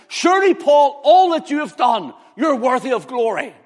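high, building admiration of Paul's merits; flattering urging. Acted. Admirers urge Paul to take credit, flattering insistence. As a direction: pile up the praise, rising to loud insistence.

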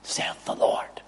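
whispered scripture ending; solemn whisper. Acted. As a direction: let it fade into a reverent whisper. Solemn close of scripture quote.

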